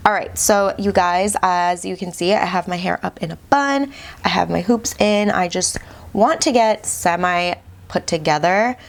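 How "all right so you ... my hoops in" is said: ordinary talk, nothing marked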